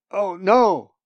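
high, loud cry of alarm; loud frightened outburst as the storm appears. Acted. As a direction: shout out in sudden fear. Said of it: "she cried".

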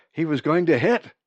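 ordinary delivery, nothing marked

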